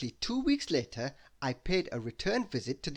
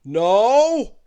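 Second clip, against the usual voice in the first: loud, higher booming "Now"; thundering giant; 7 semitones higher, 15 dB louder than usual. Acted. Giant wizard starting to question his visitor, thundering. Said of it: "thundered Wizard Winterbottom".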